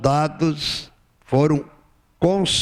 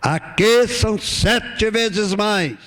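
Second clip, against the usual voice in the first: king's command; 7 semitones higher, much slower than usual. Retold or imitated. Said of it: "do soberano na Babilônia".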